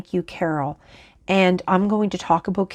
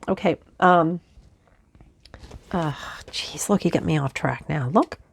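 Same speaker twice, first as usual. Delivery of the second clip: normal talking voice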